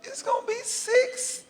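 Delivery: tiny, very high feeble whimper; hammed-up faint, broken falsetto; sounding weak. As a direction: squeak it out weakly, halting before the amount. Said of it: "sounding weak".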